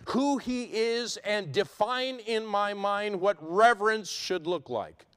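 high, loud, weighty pulpit delivery; forceful preaching. Real, not acted. Preacher decrying worldly worship and defining reverence, forceful preaching.

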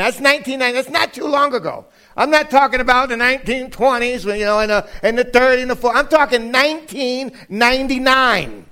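high, insistent pulpit delivery; emphatic preaching. Real, not acted. Preacher stresses how recent the date is, emphatic preaching. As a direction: hammer the point home to the congregation.

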